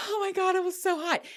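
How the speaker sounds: high, giddy exclamation; overcome with excitement. Real, not acted. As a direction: let the voice leap up, thrilled. Overcome by her own memory.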